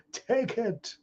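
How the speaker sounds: caricatured high-pitched demand; acted attacker's command. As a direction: play the invader in a squeezed high voice. Acts invading attacker.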